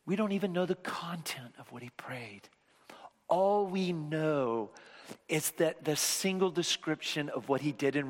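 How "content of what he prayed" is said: whisper. Acted. Sermon drops to hushed tone.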